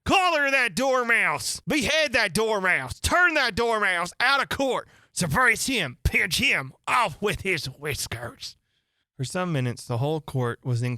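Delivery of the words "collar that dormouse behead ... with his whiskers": high, loud screaming commands; furious shouting. Acted. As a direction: shriek orders at the court. Queen shrieking orders.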